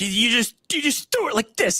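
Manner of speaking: high, grandfatherly coach voice; high mock old-man coaching voice; imitating the old coach. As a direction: mimic an old coach showing how to throw. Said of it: "the way he would say it always used to crack me up".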